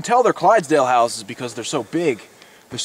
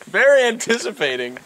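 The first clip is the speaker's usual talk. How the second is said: mock pompous; 7 semitones higher than usual. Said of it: "said the English major".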